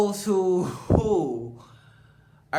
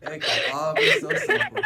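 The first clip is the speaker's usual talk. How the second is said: higher, laughing through the words. Real, not acted. Friend chimes in while laughing at the story. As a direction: say it while cracking up.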